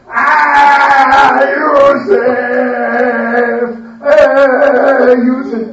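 loud, high hammed-up wailing of the name; fake wailing together. Brothers wailing in one voice before their father. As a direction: cry it out in unison, no tears. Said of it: "They all in one sound".

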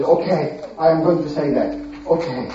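low, soft reluctant okay; grudging agreement. Acted.

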